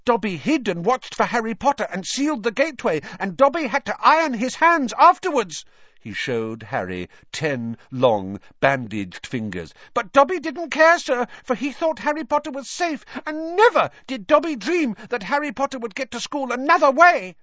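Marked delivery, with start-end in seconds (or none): 0.0-5.6 high, squeaky elf confessing; high-pitched, eager servile creature; earnest house-elf. A narrator's reading. 9.9-17.3 high, squeaky elf confessing; high-pitched, eager servile creature; earnest house-elf. A narrator's reading.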